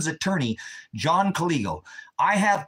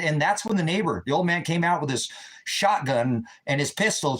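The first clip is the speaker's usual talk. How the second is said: ordinary talk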